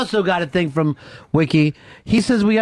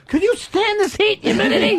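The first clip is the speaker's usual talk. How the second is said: high-pitched mock griping; mock complaint; 13 semitones higher than usual. Host mimics Miami people griping about heat, high mock complaint. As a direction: whine like an overheated local, much higher.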